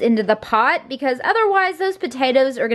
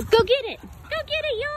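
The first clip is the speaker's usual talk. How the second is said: much higher, eager call to fetch; excited calling; 13 semitones higher, 6 dB quieter than usual. Real, not acted. Owner urges her dogs across the park to chase the ball, eager and much higher. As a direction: cheer the dogs after the ball.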